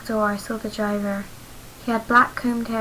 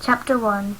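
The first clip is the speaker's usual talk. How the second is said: ordinary delivery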